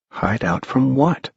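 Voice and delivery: put-on a puzzled boy asking; high hushed boy; wondering boy. A narrator's reading. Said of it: "wondered Tom".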